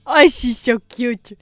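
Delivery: slow, doting coo; gushing. Real, not acted. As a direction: coo over a cute puppy. Gushing over dog photo.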